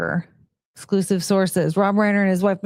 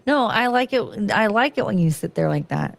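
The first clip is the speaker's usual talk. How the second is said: usual speaking voice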